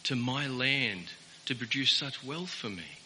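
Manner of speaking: mild searching rich man asking what God wants of him; humble questioning man. Retold or imitated. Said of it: "the man should have said".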